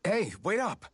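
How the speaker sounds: high call after them. Acted. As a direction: shout after friends to wait. Calling after departing friends.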